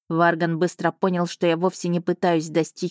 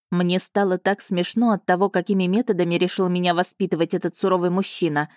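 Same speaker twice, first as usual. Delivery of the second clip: plain reading voice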